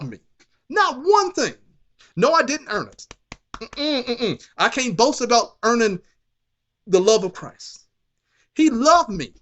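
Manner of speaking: high, loud preaching shout; preaching at full force. Real, not acted. Preacher shouting grace to the congregation, full force. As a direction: let the praise ring out. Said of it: "I feel like shouting now".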